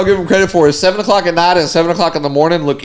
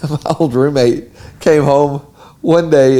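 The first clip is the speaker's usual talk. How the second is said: ordinary talk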